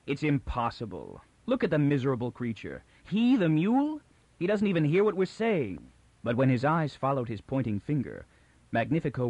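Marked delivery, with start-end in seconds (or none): 0.1-2.8 harsh. A narrator's reading. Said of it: "Toran said harshly and with finality". 3.0-4.0 high, incredulous scoff; sneering disbelief, much higher; scornful disbelief. A narrator's reading. 4.4-5.9 harsh. A narrator's reading. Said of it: "Toran said harshly and with finality".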